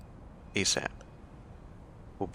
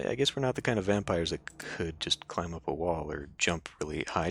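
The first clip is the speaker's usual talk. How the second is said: normal talking voice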